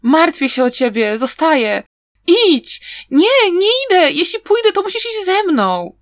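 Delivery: mock melodrama. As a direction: overplay a tearful farewell in a mocking, squeaky voice. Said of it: "czegoś w deseń".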